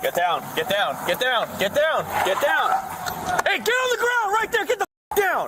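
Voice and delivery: rising, urgent barked commands; shouting orders over battle. Real, not acted. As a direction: yell over the chaos. Officers storming a suspect.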